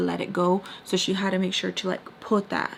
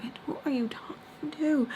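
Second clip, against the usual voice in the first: indignant look voiced; 7 semitones higher, 5 dB quieter than usual. Boyfriend offended by her attitude, imitated indignation. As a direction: imitate his offended disbelief, pitched up. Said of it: "still trying to look at me like".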